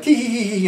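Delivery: high, imitated tee-hee-hee; put-on high titter; mock giggle. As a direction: giggle like the woman in the story.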